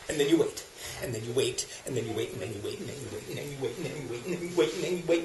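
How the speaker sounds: hushed and low, sinking very soft; endless waiting. Acted. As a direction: murmur the repeats as the wait drags on. Same endless waiting joke repeated.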